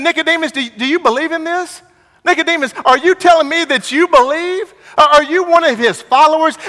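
high-pitched, incredulous taunting questions; imitating scornful accusers; mocking disbelief. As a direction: make fun of him, pitch way up. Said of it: "They're making fun of him".

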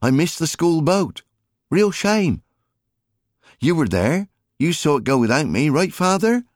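put-on high, teasing boy's voice; a cheeky lad pleading innocence, pitched well up; mischievous boy. A narrator's reading. Alfie teasing Jim.

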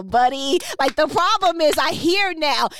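ordinary delivery

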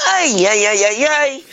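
high, loud robot alarm; high, loud cartoon wailing; Alpha from Power Rangers. Shown as a demonstration. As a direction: belt it out like a panicking little robot. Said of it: "that old Alpha does".

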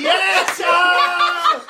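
high, loud whoop of agreement; excited cheer. Real, not acted. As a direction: shout it out with delight. Cheering the catchphrase.

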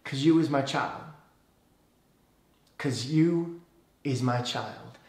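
quiet, unhurried Southern answer; Southern, almost Cajun accent. Acted. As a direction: answer softly in a lightly Cajun-tinged voice, with pauses. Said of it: "God speaks in the same way that Moses does".

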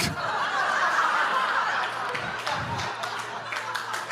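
high airy giddy laughter; breathless giddy laugh. Retold or imitated. Healed at the altar, breathless high giddy laugh. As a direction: laugh out of pure disbelief. Overjoyed after healing.

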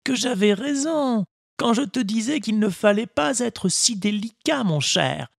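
put-on a patient old woodcarver advising his boy; kindly old Geppetto. Geppetto voiced in story.

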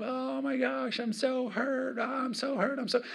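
put-on whiny kid voice, way up high; hysterical hurt child. Pastor mimics a hurt kid to the congregation, whiny and high. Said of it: "they're just hysterical".

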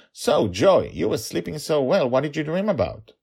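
high, lilting question; chatty playful dad. Acted. Dragon father asking daughter about her dream, playful curiosity. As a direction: ask with lifted pitch and a questioning hum.

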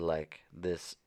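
usual speaking voice

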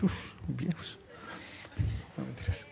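"uf, viejos" muttered far under the breath; muttered aside. Acted. Preacher teasing older parents, hushed aside.

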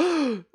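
sharp high gasp; a quick theatrical gasp of horror; mock gasp. Realising he summoned the spider.

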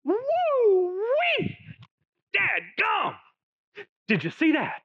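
very high Arkansas whoop on "Woo-wee", shouted "dadgum"; Arkansas holler. Pretend. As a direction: whoop like a dazzled Arkansas country boy. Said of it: "in Arkansas, we would say it this way".

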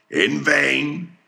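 high, loud cry; cried out. Acted. Ahab to his mates, crying out high and loud. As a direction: cry out in bitter frustration. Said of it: "cried Ahab".

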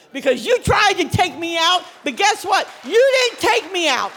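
rising loud and high, taunting the enemy; triumphant shout. Acted. Preacher taunting Satan to the congregation, triumphant shout. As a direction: hurl it at Satan like a victory cry. Declaring victory over Satan.

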